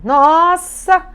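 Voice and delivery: pai empolgado defendendo o filho, alto e agudo; exaltação encenada. Acted. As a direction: exclame alto, esticando as palavras. (exaltado, com voz alta e aguda)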